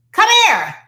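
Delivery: loud, high, sharp command; yelling. Acted. Parent calling a misbehaving child over, yelling. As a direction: shout it as a scolding order. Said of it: "do we have a sense of yelling?".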